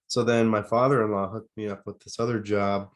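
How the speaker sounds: normal talking voice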